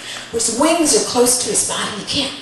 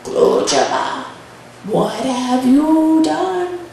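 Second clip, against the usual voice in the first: suspicious grandmother; much slower than usual. Acted. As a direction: draw out an accusing question. Grandmother questions the boy suspiciously.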